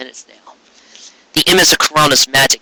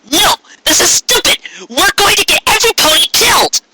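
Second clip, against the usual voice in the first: protesting cry. Acted. Trixie protests, stop this madness.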